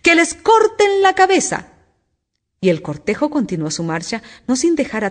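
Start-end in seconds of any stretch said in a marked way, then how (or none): 0.0-1.7 high, loud, drawn-out outcry; royal shouted order. A narrator's reading. Queen condemns the gardeners, shouting. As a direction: cry out the sentence, stretched and high.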